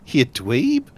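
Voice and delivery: ordinary talk, nothing marked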